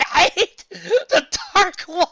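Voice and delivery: high shrieking yell, cracking into laughs; enraged squeal mixed with laughter; rage scream through laughter. Acted. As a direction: scream it, barely holding back a laugh. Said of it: "screamed in utter rage".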